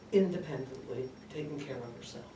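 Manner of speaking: usual speaking voice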